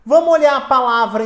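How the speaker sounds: ordinary delivery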